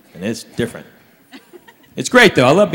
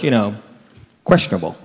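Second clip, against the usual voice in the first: normal talking voice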